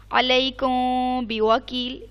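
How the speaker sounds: अलैकुम बिवकील recited slowly, the मीम held with a nasal hum; slow Quranic recitation of अलैकुम बिवकील, ghunna on the मीम; recited example. Shown as a demonstration. Teacher reciting अलैकुम बिवकील for her student, slow nasal ghunna. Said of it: "इख्फा का मीम छुपाना".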